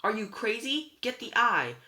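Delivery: high, loud exasperation; frantic shout. A narrator's reading. Girl rejecting the backpack request, frantic shout. Frantic refusal during taxi panic.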